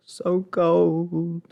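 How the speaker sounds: high, drawn-out acted moan ending in a gasp; shivering lament. Player voices the freezing heroine's diary, shivering lament. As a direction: wail it like someone freezing, with a gasp.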